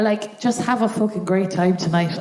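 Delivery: normal talking voice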